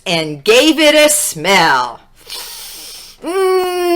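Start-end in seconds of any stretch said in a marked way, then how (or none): 3.2-3.9 appreciative hum. Acted. Smelling a rose.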